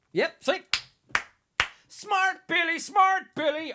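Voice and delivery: high gloating singsong. Real, not acted. Host bragging to listeners, high gloating. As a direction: crow about being right. Gloating that his prediction came true.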